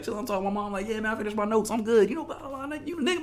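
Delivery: mock breezy chat. Mock casual phone chat.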